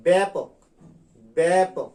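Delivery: teacher modelling the right way; correct pronunciation. Shown as a demonstration. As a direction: stress ব্যাপক, spreading the lips. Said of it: "বলতে হবে".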